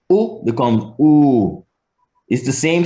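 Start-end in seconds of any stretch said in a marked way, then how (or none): 0.1-1.6 u stretched slowly; long vowel. Shown as a demonstration. Teacher showing Jamaican vowel length, long u. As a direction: hold the u out in time. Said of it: "just stretched out in time".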